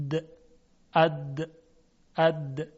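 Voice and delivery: د and اد with a buzzing voiced د; voiced d demo. Shown as a demonstration. Teacher sounding اد so students feel throat vibration. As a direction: hold the voicing on each اد. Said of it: "و دال رو تلفظ کنید".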